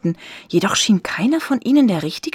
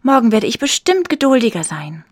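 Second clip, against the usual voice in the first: glad, raised-voice promise; joyful; 5 semitones higher, 5 dB louder than usual. A narrator's reading. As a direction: promise it happily, higher and louder. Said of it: "sagte sie freudig".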